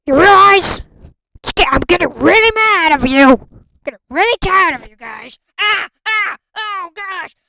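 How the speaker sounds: loud frustrated yell; angry yelling. Acted. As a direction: scold them at full volume. Yelling at players.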